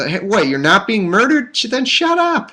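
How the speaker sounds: put-on fed-up parent yelling at noisy kids; mock parent yelling. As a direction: snap at the kids like a fed-up dad. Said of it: "if those were my kids, I go".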